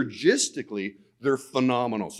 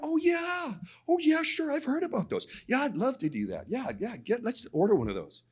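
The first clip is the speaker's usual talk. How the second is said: much higher, gushing yes-yes voice; mock eager agreement; 10 semitones higher, 3 dB quieter than usual. To his doctor about the calcium test, mock eager agreement. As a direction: play up his own overeager reply.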